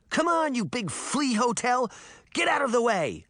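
put-on high, exasperated girl's shout at a huge dog. A narrator's reading. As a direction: yell at the dog to move, fed up. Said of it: "Sabrina shouted".

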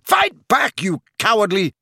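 high, loud insult cut short; furious scream. A narrator's reading. Harry goading Snape, screamed in fury. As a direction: scream the challenge, breaking off mid-insult. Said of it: "Harry screamed at him".

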